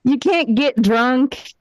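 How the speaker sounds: usual speaking voice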